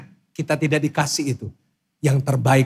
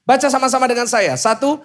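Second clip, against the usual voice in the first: loud, rousing pulpit call; rally the congregation to read along; full-force preaching; 5 dB louder than usual. Acted. (khotbah berapi-api)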